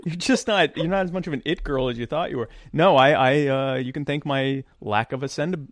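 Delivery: ordinary talk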